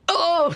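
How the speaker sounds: one long high "ow"; high pained yelp; cry of pain. Acted. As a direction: wail briefly in pain. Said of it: "बहुत दर्द हो रहा है".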